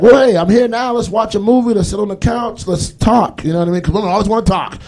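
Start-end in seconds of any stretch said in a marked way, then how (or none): 0.0-3.4 mock eager boyfriend. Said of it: "And it's like".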